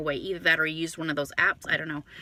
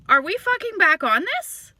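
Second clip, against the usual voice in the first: loud, high-pitched exasperated question; retold incredulity; 11 semitones higher, 8 dB louder than usual. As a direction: retell it loud and high, in disbelief. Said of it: "I'm like".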